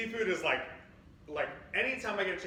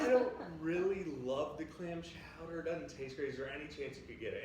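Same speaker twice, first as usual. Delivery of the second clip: mock-timid complaint, trailing softer; worried complaint; 4 dB quieter than usual. Couple nervously asking waitress for new chowder, mock-timid. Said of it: "I'm all worried".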